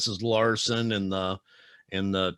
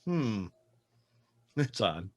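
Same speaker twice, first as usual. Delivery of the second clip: mock-puzzled reaction, pitched up; retold disbelief; slower than usual. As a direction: act out being taken aback. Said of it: "It's like".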